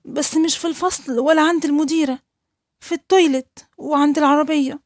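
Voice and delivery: put-on a small boy confessing something terrible; frightened little boy. Boy tells teacher the abuse.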